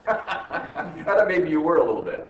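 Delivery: amused, slowed by laughter; real laughing. Real, not acted. Teacher admitting a wrong guess to a student, laughing.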